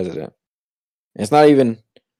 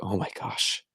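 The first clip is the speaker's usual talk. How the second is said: hushed, much softer exhalation; retold flustered; 3 semitones lower, 12 dB quieter, slower than usual. Clerk alone in the back after customer, retold flustered. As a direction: let it out almost voiceless, re-enacting relief from pressure. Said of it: "I was flustered".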